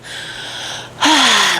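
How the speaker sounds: acted long sigh; heavy exhale in character; weary sigh. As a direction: let out a resigned sigh. Horse sighs before offering help.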